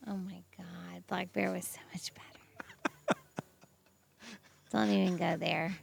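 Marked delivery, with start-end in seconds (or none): 0.1-3.2 whispering. Real, not acted. Protesting film ranking.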